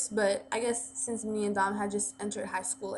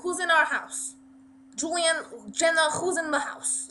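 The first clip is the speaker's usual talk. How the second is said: lightly done New York mom, raised pitch; a hint of mom's New York accent, higher; 6 semitones higher than usual. Mom questioning her children, lightly imitated New York accent. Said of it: "she has a New York accent".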